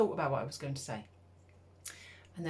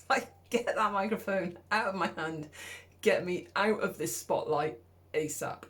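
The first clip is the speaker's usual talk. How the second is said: mock panic; 3 semitones higher, 5 dB louder than usual. Panicked inner voice after freezing.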